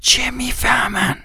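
secretive whisper, very hushed; urgent whisper. Acted. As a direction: hiss it softly before anyone comes. Secret whispering behind doctor's back.

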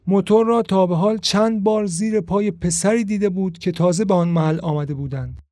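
ordinary delivery

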